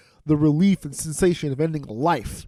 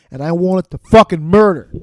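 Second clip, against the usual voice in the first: loud burst of comic rage on murder; mad outburst; 9 dB louder than usual. Real, not acted. Comedian reliving toothache rage, loud angry outburst. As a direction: blow up at the memory, full volume. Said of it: "look how mad I got".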